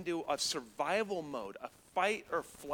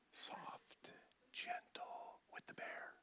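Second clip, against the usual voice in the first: slow, hushed whisper; barely-there gentle whisper; soft, gentle whisper; 15 dB quieter, much slower than usual. Shown as a demonstration. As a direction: whisper as if soothing a bear. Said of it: "speak in soft, monotone voice".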